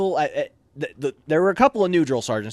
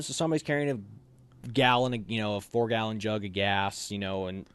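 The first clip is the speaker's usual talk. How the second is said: ordinary delivery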